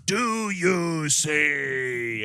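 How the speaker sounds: very slow, stretched-out villain cry; hammed-up demonic taunt, every word dragged long; Sam Neill. Eyeless Sam Neill taunts Fishburne, mock menacing. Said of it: "is yelling at him like".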